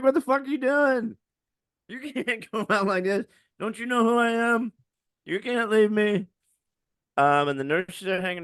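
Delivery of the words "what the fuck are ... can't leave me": raised, half-laughing anger at the dead; mock outrage through grief; angry disbelief, a laugh breaking in; pissed. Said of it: "I was pissed".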